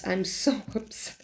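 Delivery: breathy whisper with a laugh inside. Real, not acted. Reviewer confessing to viewers, whispering through a laugh. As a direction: laugh it out in a whisper, half-joking.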